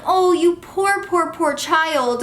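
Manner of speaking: high, drawn-out fake sympathy; mock pity. Minister pitying the crying toddler, high and drawn out. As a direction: pity the child, sarcastically.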